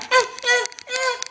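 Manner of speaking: put-on high, whiny fussing toddler noises; imitating toddler whine. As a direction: mimic a fussy baby squirming. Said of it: "That's how she sounded".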